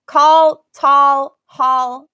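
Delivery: call, tall, hall loud with an open ah vowel; rhyming drill. Shown as a demonstration. Teacher drilling rhyming words loudly for students. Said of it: "all those all sounds are the same".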